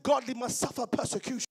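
loud, fervent sermon delivery; preaching at full force. Acted. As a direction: preach it with rising fire. Preaching builds to loud fervour.

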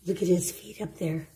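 usual speaking voice

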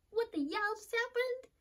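high-pitched mock crying disbelief; tearful anger. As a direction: imitate yourself wailing in angry confusion. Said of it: "I'm crying because I'm angry".

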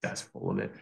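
low, soft muttered scoff; a quiet doubtful grumble under the breath; skeptical scoff. Retold or imitated. Doubting surgeon friend scoffs at the claim, low muttered scoff.

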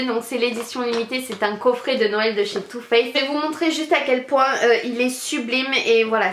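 ordinary delivery

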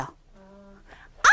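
아 short, dropping abruptly from high; fourth tone. Shown as a demonstration. Teacher models fourth tone, sharp falling 아. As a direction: cut 아 off in a quick fall. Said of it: "솔에서 같이 내려가는 거죠".

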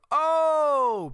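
big surprised crowd "Oh!", high and loud; amazed crowd exclaim; crowd gasp. Retold or imitated. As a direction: shout it like a whole tailgate reacting. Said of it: "the whole entire tailgate just going".

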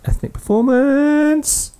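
high, long-drawn-out call; playful announcement. Pretend. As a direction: stretch the words out high and comically.

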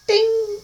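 squeaky high ding sound; ping it out like a bell, very high. Acted. Mimicking a stage guy's wink with a high ding. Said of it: "You just go, ah".

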